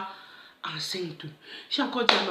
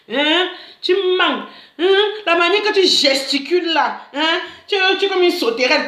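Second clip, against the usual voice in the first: higher, teasing scold; mocking mother; 6 semitones higher than usual. Mother mocking her daughter's fidgeting, teasing higher scold. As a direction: tease her for fidgeting like a grasshopper.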